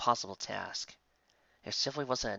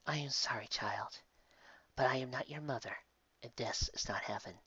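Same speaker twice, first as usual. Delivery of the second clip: gentle angel; 2 semitones higher, 7 dB quieter than usual. Acted.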